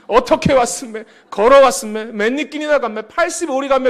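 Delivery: Pyongan-dialect quote, played for laughs. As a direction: read the Pyongan dialect lines with a northern lilt. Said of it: "문장 배운 거 들어보세요".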